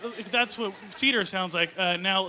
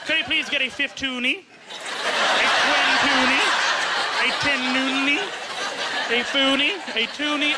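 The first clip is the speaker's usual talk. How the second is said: request each coin carefully, slightly raised; mock bank customer; 3 semitones higher than usual. Customer asking a teller for change, mock polite listing.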